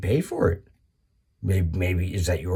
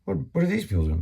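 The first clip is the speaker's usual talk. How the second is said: puzzled outburst; 7 semitones higher, faster than usual. Retold or imitated. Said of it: "'cause I go".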